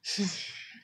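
short breathy exhale; brief role-play sigh; sad sigh. Pretend. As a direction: give a small pretend-sad sigh.

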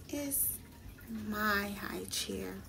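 put-on a hurt boy's soft, slow protest; whispered. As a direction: breathe it out under your breath. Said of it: "whispered Peter".

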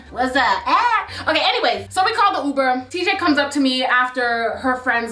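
ordinary delivery

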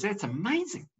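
high-pitched amazed joke character; mock amazement. Joke character amazed at correct guess.

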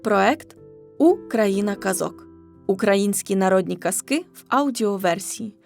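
plain reading voice

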